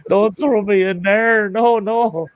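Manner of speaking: high mock plea of a cat; pleading cat. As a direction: beg as a panicking cat. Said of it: "I'm sure in his mind was".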